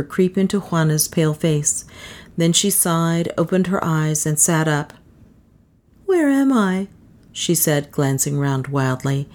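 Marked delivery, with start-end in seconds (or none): 6.0-6.9 high-pitched waking confusion; woman's voice, startled awake; bewildered woman. A narrator's reading. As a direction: ask it high, lost and disoriented. Wakes confused, glancing round wildly.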